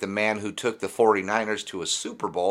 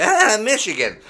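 higher, louder taunting call; mocking Iowa fan; 11 semitones higher, 5 dB louder than usual. Imitating an old Iowa fan jeering at a Michigan bottle, loud. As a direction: sneer at the water bottle. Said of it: "be like".

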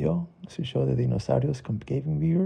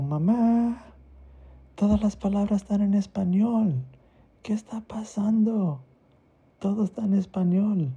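put-on panicked child; 8 semitones higher than usual. Teo panics over Spanish books.

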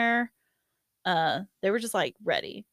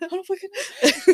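laughing through it; 10 semitones higher, 6 dB louder than usual. Retold or imitated. Retelling her own crying outburst.

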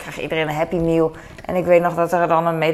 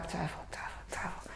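whispered doubt; 20 dB quieter, faster than usual. Real, not acted. Vlogger at the dishwasher, whispering her doubt. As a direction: whisper it to yourself, hesitating. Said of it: "doubting whisper".

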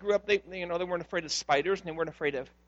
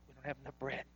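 much softer, lower mumbled excuse; quiet, lower sheepish reply; sheepish disciples; 6 semitones lower, 19 dB quieter, faster than usual. Acted. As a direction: disciples owning up meekly under their breath. Disciples answering Jesus, voiced.

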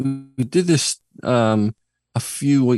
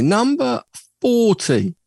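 chart announcer; 11 semitones higher, slower than usual. Acted. Starting the chart countdown.

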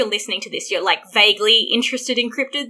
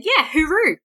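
Aussie accent; 5 semitones higher than usual. Shown as a demonstration. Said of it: "I wanted to teach you that one".

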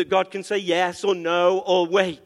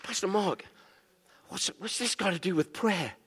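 a soft, hushed questioner from the congregation; quoted questioner; 7 dB quieter than usual. Retold or imitated. A churchgoer puzzled by the sermon asks softly. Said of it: "He said".

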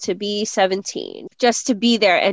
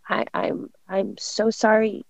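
soft apology; 5 dB quieter than usual. Retold or imitated. Retold apology to upset son.

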